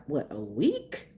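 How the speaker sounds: ordinary talk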